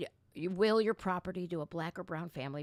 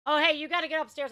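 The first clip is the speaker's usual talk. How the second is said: hurried urging; 7 semitones higher, 8 dB louder, faster than usual. Real, not acted. Hurrying child away.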